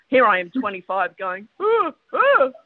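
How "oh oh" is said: put-on high, gasping young-teacher worry; hammed-up anxious squeak; mock panic. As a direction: act out a rookie fearing she is in trouble. Voices her worried younger self.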